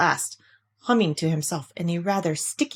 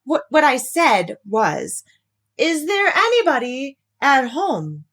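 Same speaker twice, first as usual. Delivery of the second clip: slower, louder, each word pushed; calling very loudly; 6 semitones higher, 4 dB louder than usual. Acted. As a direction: shout it again so Rabbit hears. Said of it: "called out Pooh very loudly".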